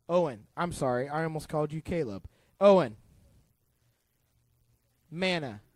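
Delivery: usual speaking voice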